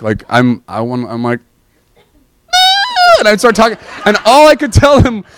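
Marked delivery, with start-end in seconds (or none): 2.0-3.2 high overwhelmed ah. Retold or imitated. Retold overwhelmed cry.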